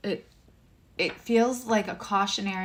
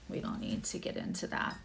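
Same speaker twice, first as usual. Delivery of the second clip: low whispered aside, brushing it off; hushed under-the-breath remark; 10 semitones lower, 7 dB quieter than usual. Real, not acted. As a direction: drop your voice and wave it away. Quick aside, dropping the subject.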